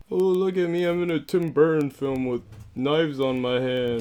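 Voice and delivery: high sneering Johnny Depp parody; mock him in a whiny voice. Mocking Johnny Depp as Edward.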